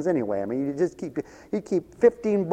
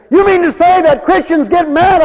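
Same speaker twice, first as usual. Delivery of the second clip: high, loud mock outrage; imitated indignant yell; 14 semitones higher, 11 dB louder than usual. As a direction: act out her shouted challenge. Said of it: "They heard you shout and yell".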